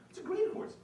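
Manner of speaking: a buyer won over by the horse; delighted buyer. Retold or imitated. Buyer to farmer after checking teeth and hooves, delighted.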